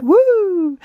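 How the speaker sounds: high, bursting woo; a joyful whoop of passion; excited whoop. Shown as a demonstration. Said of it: "I get really excited and passionate".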